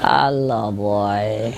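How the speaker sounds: low, soft, drawn-out greeting; bird talking in dreams. Retold or imitated. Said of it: "they talk in a dream".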